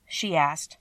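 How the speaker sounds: usual speaking voice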